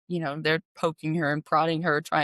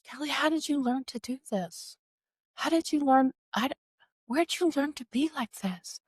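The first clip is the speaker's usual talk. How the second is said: wondering mother; 8 semitones higher than usual. Retold or imitated. Delirious mother asking her daughter, in plaintive wonder. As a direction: imitate a bewildered mother's questioning.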